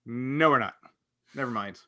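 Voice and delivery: ordinary talk, nothing marked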